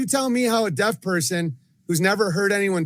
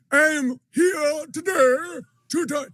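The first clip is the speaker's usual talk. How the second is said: mocking British impression, pitched way up; mock British accent; 10 semitones higher than usual. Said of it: "she has a British accent".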